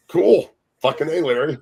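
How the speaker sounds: high, loud retold enthusiasm; mock approval. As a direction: cheer him on with put-on approval. Retells his reply to Larry.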